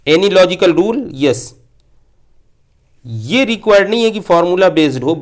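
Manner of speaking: usual speaking voice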